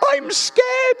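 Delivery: high scared little voice; frightened squeak. Acted. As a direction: squeak it out in fear. Daniel frightened in the den.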